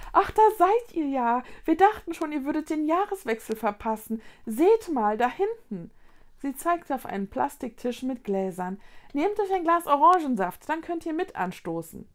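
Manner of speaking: high, bright motherly call; cheerful mother. Acted. Mother finds the children and calls them to toast. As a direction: bustle in and gather the kids.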